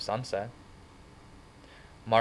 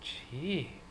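softer, hushed and surprised; 6 semitones higher, 8 dB quieter than usual. Acted. Johnny to Ponyboy, softly surprised. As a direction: breathe it out gently. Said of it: "Johnny said softly".

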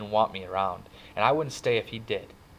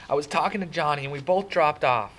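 raised-pitch, insistent excuse; pleading; 7 semitones higher, 5 dB louder than usual. A narrator's reading. Kid explaining to angry brother, pleading. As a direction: explain yourself urgently. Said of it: "I pleaded with Derry".

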